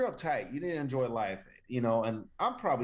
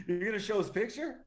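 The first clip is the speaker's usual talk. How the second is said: can't believe he'd do it; surprised; 8 semitones higher than usual. Real, not acted. Co-host shocked the host will show the man's photo, surprised. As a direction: pitch jumps up, alarmed.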